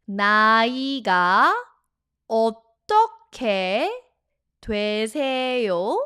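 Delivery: "나이가 어떻게 되세요?" slowly, pausing after each word; slow demonstration. Shown as a demonstration. Teacher saying "나이가 어떻게 되세요?" for learners to repeat, slowly. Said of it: "I'll say it slowly".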